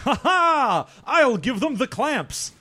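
high, slow gleeful robot; laughing high robot voice. Acted. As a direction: cackle in the robot role.

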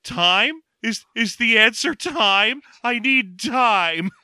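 high, slow, wondering mock sincerity; earnest baffled henchman. Beaten henchman earnestly answering a philosophy question, mock-plaintive. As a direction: stretch it out high, groping for the answer. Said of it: "He just is like".

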